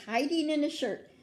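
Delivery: usual speaking voice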